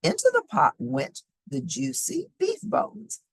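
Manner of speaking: usual speaking voice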